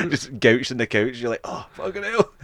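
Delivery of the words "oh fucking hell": much higher pained mock groan; hungover groan. Hungover friend slumped on the couch, higher pained mock groan.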